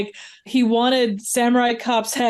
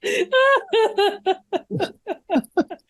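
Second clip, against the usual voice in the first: laughing; 9 semitones higher, slower than usual. Real, not acted.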